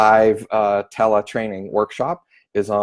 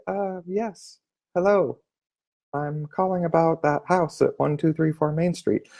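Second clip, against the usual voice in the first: much softer, gentle and higher; soft matching tone; 6 semitones higher, 6 dB quieter than usual. Shown as a demonstration. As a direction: ease in softly like she does. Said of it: "Now I'm in rapport".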